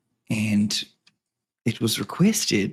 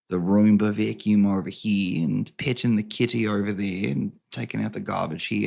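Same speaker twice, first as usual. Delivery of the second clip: normal talking voice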